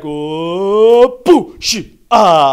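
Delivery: high, loud put-on jeering; mock fan jeers. American crowd jeering, mimicked high and loud. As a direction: boo like an overeager new fan.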